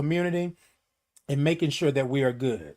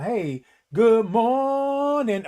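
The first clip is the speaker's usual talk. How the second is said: drawn-out greeting; 5 semitones higher, much slower than usual. Real, not acted. Greeting a viewer.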